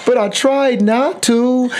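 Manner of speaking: high, drawn-out mock whine; slow, high-pitched tearful protest; tearful daughter. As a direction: imitate a crying child pleading her case. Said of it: "crying, and she's like".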